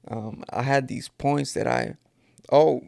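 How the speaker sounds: usual speaking voice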